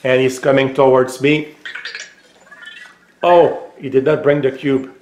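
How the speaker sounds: ordinary delivery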